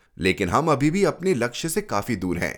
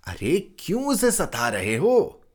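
higher, reproachful aunt's voice; 7 semitones higher than usual. Acted. Aunt reproaches the father for troubling the boy, higher voice. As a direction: chide gently as the aunt. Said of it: "बुआ ने कहा".

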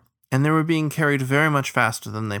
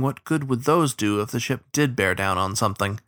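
usual speaking voice

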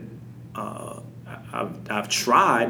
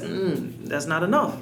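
higher, put-on voice dismissing it; mock curt reply; 6 semitones higher, slower than usual. Parent answering the excuse in a mock dismissive tone.

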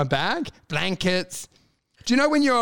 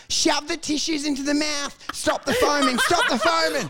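high, urgent mock shouting; hammed-up amateur medic in a flap; frantic ordering; 7 semitones higher than usual. Fake doctor orders helpers during a fit, frantic. Acted panicked first aid orders.

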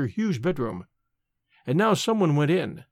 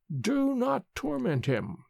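high, slow, soft crone's plea; a frail old woman begging gently; lightly voiced ancient nurse, high and hushed; 7 semitones higher, 5 dB quieter, slower than usual. A narrator's reading. Old nurse stops the cup.

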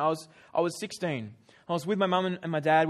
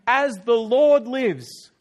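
slow, loud angry vow; angry oath; 3 semitones higher, 10 dB louder, much slower than usual. Acted. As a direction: swear it slowly in fury. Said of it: "David was infuriated".

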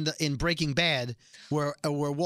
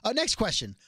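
higher, hesitant brush-off; mock evasive God, raised pitch; flustered dodge; 6 semitones higher than usual. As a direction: stammer lightly and move things along. God dodging Jesse's question.

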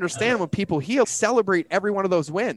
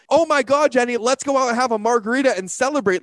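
higher and louder, bursting with joy; excited cheer; 5 semitones higher, 3 dB louder than usual. Retold or imitated. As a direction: celebrate her like a thrilled friend. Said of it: "what am I, like".

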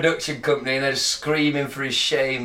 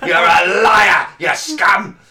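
mock berating shout; 3 semitones higher, 8 dB louder, much slower than usual.

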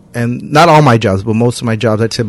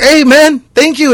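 high-pitched, eager thank-you; overjoyed at a one-dollar tip; excited thanks; 13 semitones higher, slower than usual. Retold or imitated. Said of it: "they get all excited for a dollar".

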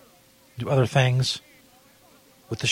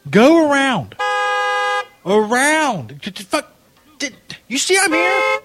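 yelling at drivers; 8 semitones higher than usual. Acted. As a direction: wave the cars off, furious.

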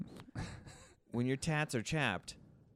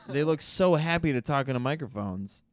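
ordinary talk

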